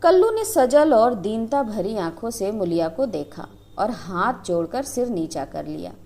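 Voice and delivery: ordinary delivery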